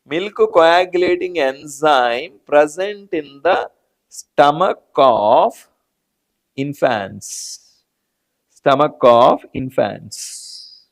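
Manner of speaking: very slow, drawn-out teaching delivery; stretched key words with pauses between phrases; slow dictation. Real, not acted. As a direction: spell it out phrase by phrase for students.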